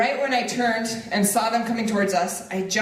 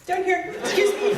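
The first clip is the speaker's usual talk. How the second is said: much higher, laughing, calling from below; 10 semitones higher than usual. Retold or imitated. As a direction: pipe up from the table with a laugh. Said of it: "And I'm like".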